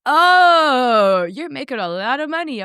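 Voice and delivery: high, drawn-out dawning approval; mock amazement with a long rising "oh"; acted-out impressed parents. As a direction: imitate parents suddenly won over.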